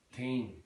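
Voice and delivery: put-on "Teen" with T for TH; Southern Irish pronunciation. Shown as a demonstration. Teacher demonstrating Southern Irish, "Teen" with T for TH. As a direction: say "Teen" for theme, hard T. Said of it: "they're saying teen".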